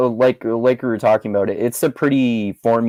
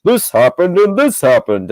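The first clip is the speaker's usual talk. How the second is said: higher, louder cheesy reporter voice; cheesy news reporter; 8 semitones higher, 6 dB louder than usual. Retold or imitated. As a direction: ham it up like a TV anchor. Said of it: "they're speaking all cheesily".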